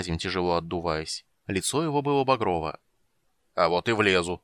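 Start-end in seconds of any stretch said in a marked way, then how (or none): none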